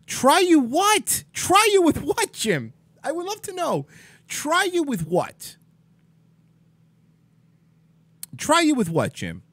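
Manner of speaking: real exasperated challenge to Jim; incredulous. Real, not acted. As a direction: stretch it out in open disbelief. Arguing with a viewer named Jim.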